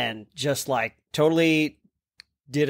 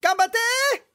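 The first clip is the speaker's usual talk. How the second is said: excited anime cheer. Shown as a demonstration. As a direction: shout it like an anime character. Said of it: "You have to say it like that".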